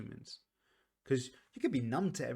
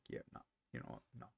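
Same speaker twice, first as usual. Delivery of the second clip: whispered, lower yeah no; whispered refusal; 11 semitones lower, 12 dB quieter, much faster than usual. Retold or imitated. Setting her own boundaries, she whispers a firm refusal. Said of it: "I'm like".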